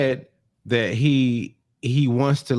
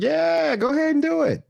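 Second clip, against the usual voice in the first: much higher, mock-breezy go-ahead; mock cheerful enabler. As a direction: egg him on like a careless friend. Mocking enabling friends.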